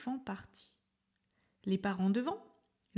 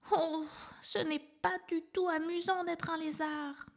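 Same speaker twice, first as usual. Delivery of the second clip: put-on high young voice, mildly let down; a little elephant rubbing his sore bottom; 8 semitones higher than usual. A narrator's reading. Little elephant after falling, high, mildly disappointed.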